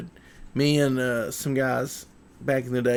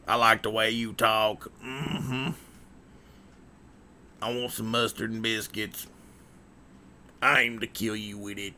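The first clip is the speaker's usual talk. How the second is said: Carl; 4 semitones lower, 6 dB quieter than usual. Acted. Streamer almost doing a movie character, low put-on voice. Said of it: "This is almost Carl from".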